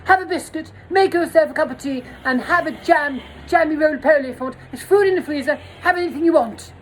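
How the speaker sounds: a hammed-up flustered host; high camp host. Retelling a high host offering tea, camp voice. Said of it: "He was like".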